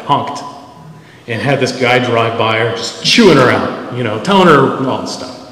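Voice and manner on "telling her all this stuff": normal talking voice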